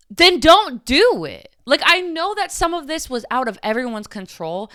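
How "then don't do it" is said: angry retort. Real, not acted. Angry retort to quoted person.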